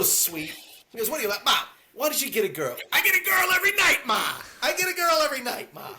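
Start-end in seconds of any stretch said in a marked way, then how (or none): 1.1-5.9 high, loud shout of the line; hammed-up loud Tommy quote; mock shouting Tommy. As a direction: belt it out, higher and louder. Acting out the dinner scene.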